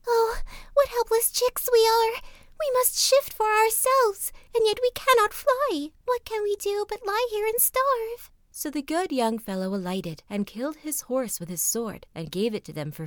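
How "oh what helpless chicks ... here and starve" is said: very high, squeaky helpless chick voices; crying chicks. A narrator's reading. Young ravens wail on the ground, very high and helpless. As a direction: wail like tiny birds that can't fly. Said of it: "flapping their wings and crying".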